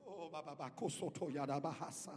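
rapid babbled syllables in tongues; speaking in tongues. Real, not acted. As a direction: let the syllables tumble out in tongues. Preacher breaks into tongues.

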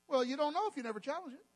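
ordinary talk

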